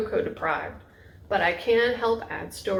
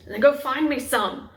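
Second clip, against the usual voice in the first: a touch of a gruff command; mild order; 5 semitones higher, 4 dB louder than usual. Acted. Husband ordering wife out for coffee, mildly gruff. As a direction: bark it lightly at her. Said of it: "he ordered".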